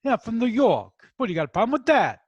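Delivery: mock New York accent, challenging; tough New York accent. Shown as a demonstration. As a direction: put on a New York accent and pick a fight. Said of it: "when I want to affect that".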